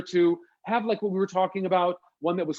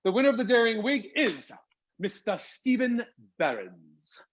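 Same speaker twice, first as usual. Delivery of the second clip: dramatic announcement; 4 semitones higher than usual. Acted. Announcing the prize winner.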